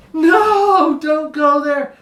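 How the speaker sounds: very high, loud alarmed shout; parent shouting. Retold or imitated. Parents shouting down at their kid again, loud alarmed yell. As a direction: yell the warning with everything.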